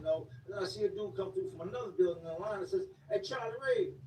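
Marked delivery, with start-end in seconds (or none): 3.1-3.9 calling out. Retold or imitated. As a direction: hail him from down the line. Calling across the lunch line.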